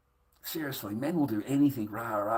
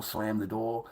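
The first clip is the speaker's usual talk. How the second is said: usual speaking voice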